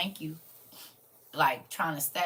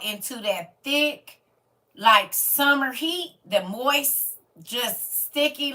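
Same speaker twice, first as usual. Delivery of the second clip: slow, savoring each heavy word; drawn out; 7 semitones higher, 8 dB louder, much slower than usual. Real, not acted. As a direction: stretch the words to make the heat felt. Describing oppressive humid heat.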